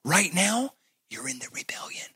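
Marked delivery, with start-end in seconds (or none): 1.1-2.1 unvoiced hushed warning; whisper. Pastor warns the listener in a whisper. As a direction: whisper it gravely.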